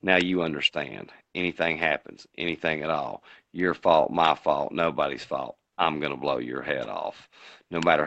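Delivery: a low, hushed take on the same threat; low menacing John Wayne. Retold or imitated. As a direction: growl it quietly back at him like a cold threat. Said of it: "and he goes".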